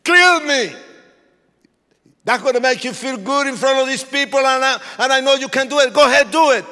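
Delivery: preacher voiced daring the gang leader; imitated screaming. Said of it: "this man started screaming at me".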